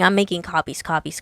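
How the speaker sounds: normal talking voice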